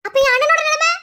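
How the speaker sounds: very high, loud disbelief; shocked outcry. Acted. Sister crying out for her brother at the news, shocked. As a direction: blurt it out in shock.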